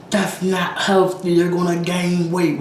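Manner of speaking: high, slow mock scolding; scolding viewers. Viewers warning her about overeating, mock scolding. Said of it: "your guys are about to be like".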